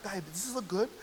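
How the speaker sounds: softly voiced woman asking a friend; soft girlfriend voice. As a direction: ask a girlfriend softly, lightly acted. Acting out a woman asking her friend.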